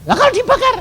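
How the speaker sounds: high-pitched anxious question, imitated; acted fretful query; worried caller. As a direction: mimic a nervous caller.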